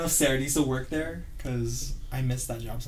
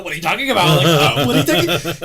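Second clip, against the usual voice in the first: loud, higher retold protest; mock incredulous; 4 semitones higher, 10 dB louder than usual. Teen denying the cigarettes to a sister, mock incredulous. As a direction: act out a loud, baffled denial.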